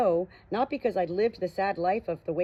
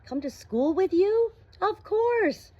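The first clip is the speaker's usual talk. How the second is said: high, slow, disbelieving; incredulous high question; shocked; 13 semitones higher, 5 dB louder, slower than usual. A narrator's reading. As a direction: ask it stunned. Shocked exchange at the car.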